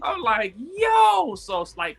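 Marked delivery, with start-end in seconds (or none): 0.8-1.3 high, loud exclaimed yo; stunned one-word exclamation; amazed yo. Retold or imitated. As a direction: let the wonder burst out. Reacting to life-changing moment.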